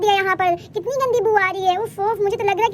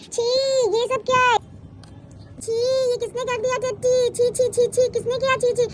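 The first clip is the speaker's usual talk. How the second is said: disgusted outcry; 3 semitones higher than usual. Acted. Child stumbles on a stinking mess, disgusted.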